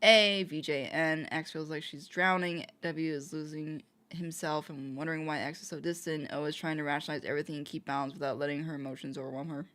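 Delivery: normal talking voice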